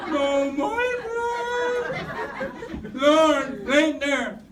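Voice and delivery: hammed-up nasal wail, "Lord" jumping high; mock nasal crying prayer. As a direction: beg the Lord through the nose, half laughing. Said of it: "and she cried". (pabirong ngongong iyak sa Panginoon)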